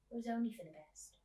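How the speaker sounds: ordinary delivery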